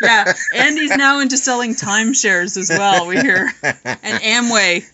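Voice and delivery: words spilling out through laughter; laughing. Real, not acted. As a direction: tease while cracking up.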